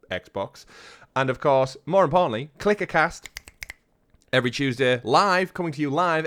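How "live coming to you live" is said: big hyped announcer call. Shown as a demonstration. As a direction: belt it like a show announcer. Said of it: "it comes into that announcer voice".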